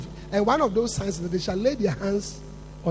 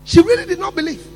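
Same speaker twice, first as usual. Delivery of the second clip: higher, louder emphatic repeat; 6 semitones higher, 9 dB louder, faster than usual. Acted. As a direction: shout it the second time. Repeated line.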